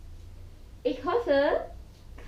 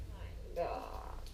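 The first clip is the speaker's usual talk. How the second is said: whispered; 7 semitones lower, 12 dB quieter than usual. Real, not acted. Disgusted by bag contents.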